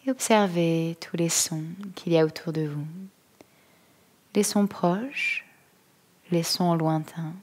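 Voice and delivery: calm, unhurried yoga nidra voice, long pauses; guided meditation. Acted. Yoga teacher settling listeners into stillness, calm guided-meditation voice. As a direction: guide them gently into stillness, phrase by phrase.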